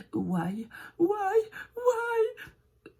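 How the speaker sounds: «why» repeated, leaping high; exaggerated demo. Shown as a demonstration. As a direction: draw out a high, playful «why». Demonstrating English Y, exaggerated repeats.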